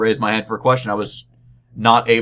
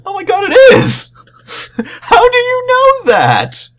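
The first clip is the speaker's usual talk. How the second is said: much higher and louder, slow astonished shriek; shocked shout; 10 dB louder, slower than usual. Retold or imitated. Presenter finding page two twenty-seven, high loud shocked shriek. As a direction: shriek it in disbelief. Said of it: "he goes".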